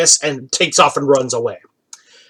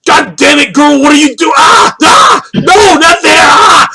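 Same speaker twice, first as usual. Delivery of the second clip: high-pitched shouting broken by screams; full-out comic protest, shrieking between words; yelling; 12 semitones higher, 6 dB louder than usual. Acted. Man yells as the girl drags him off, high screaming protest. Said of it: "You hear him yelling".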